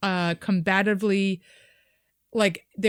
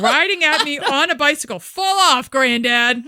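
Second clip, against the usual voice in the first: put-on jeering teens; 6 semitones higher, 7 dB louder than usual. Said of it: "Aggressive boys".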